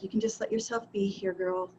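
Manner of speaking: soft, falling, talking yourself down; calm yourself gently, ending low; soothing self-talk. Retold or imitated. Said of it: "And then I was just like".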